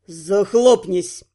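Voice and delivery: slow, low-pitched growl; growled order. A narrator's reading. Prince silences the couturier, growling. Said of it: "рыкнул он".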